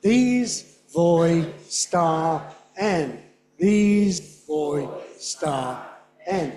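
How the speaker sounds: "These voi star en" drawn out slowly; very slow syllables. Shown as a demonstration. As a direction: crawl through each syllable. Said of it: "Try this one".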